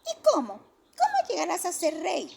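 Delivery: put-on loud, high kids yelling; shouting children. A narrator's reading. The other children shout at Johnny, loud high child voices. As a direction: yell it across the playground. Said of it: "le gritaron los demás".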